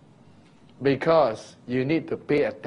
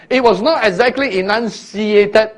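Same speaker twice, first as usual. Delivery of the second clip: ordinary talk, nothing marked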